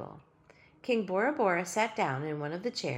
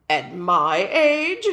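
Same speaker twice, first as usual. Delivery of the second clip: loud, very slow and scornful; indignant snort; 12 dB louder, much slower than usual. Acted. Tiny king scoffing at peanut butter for breakfast, slow and indignant. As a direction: scoff at the idea, affronted. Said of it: "the king snorted".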